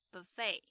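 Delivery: buffet with stress on the end, bu-FAY; 美式发音buffet; American pronunciation. Shown as a demonstration. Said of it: "吃到饱自助餐的美式发音".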